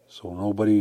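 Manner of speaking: ordinary talk, nothing marked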